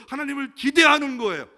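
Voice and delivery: loud, high climactic cry; preaching shout. Real, not acted. Preacher crying out to the congregation at the climax. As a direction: shout it from the pulpit.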